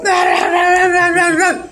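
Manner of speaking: very high, rapid-fire babble; high-pitched cartoon frenzy; frantic mock wailing. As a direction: squeal it in a rapid string. Mimics wrestlers trying to get high.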